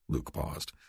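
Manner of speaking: plain reading voice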